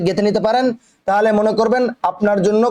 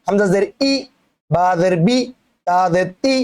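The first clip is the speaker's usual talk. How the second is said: ই, বি, তি cut short; short vowels; slower than usual. Shown as a demonstration. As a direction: end ই, বি, তি promptly, with no stretch. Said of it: "উচ্চারণ তাড়াতাড়ি".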